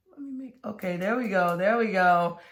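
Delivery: ordinary talk, nothing marked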